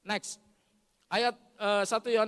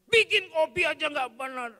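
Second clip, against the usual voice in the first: loud, high outburst at a wife; angry scolding; 8 semitones higher, 12 dB louder than usual. Acted. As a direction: play a husband yelling at his wife. Acts husband scolding his wife. (membentak istri dengan marah)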